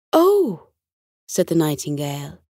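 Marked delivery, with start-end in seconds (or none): 0.1-0.7 high, falling "oh" of realisation; a little abashed. A narrator's reading. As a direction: let it drop from high up, abashed. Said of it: "looking a little abashed".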